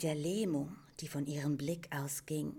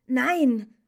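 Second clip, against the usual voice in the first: loud, high, anguished refusal; almost wild outburst; 9 semitones higher, 14 dB louder than usual. A narrator's reading. Atreyu rejects the Empress's praise, nearly wild. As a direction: burst out with it. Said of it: "stieß Atreyu fast wild hervor".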